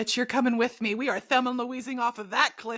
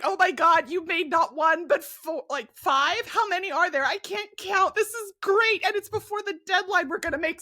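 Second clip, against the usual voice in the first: higher, bubbling with glee over paintings; excited Thomas; 7 semitones higher than usual. Retold or imitated. Co-host imitating Thomas gushing over finished paintings, excited and high. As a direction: gush like a thrilled manager who can't count. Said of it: "He'd be just so excited".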